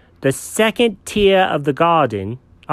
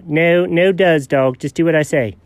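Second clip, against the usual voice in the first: ordinary talk, nothing marked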